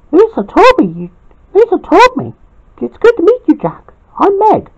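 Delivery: put-on high, friendly girl's voice; Meg's voice. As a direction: pitch it up and greet him warmly. Meg greets Jack.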